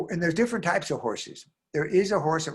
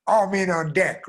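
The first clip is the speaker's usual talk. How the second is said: mock captain's yell; 5 semitones higher, 5 dB louder than usual. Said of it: "the ship captain yelling".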